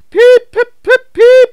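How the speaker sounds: very high, loud whistle calls; shrill steam whistle; whistle toots. A narrator's reading. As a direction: Edward whistling encouragement. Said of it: "whistled Edward".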